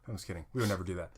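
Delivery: ordinary talk, nothing marked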